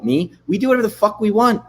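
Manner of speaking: usual speaking voice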